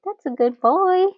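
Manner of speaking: high, loud praise for a good dog; praising the dog. Retold or imitated. Cheering the police dog on TV, high and loud. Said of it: "we were saying, like".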